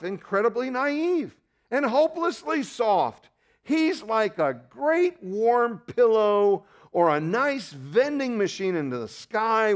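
slow, high mock tenderness; mocking sweetness. Mocking the soft-God theology.

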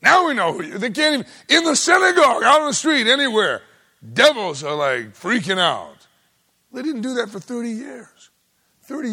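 loud, high, shouted sermon; preacher at fever pitch; full-force preaching. Acted. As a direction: build to a shout about the devils.

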